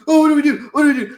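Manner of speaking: loud, high-pitched flustered cry; hammed-up frantic shouting; mock panic. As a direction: imitate a group panicking, shrill and loud. Imitates characters panicking at honking horn.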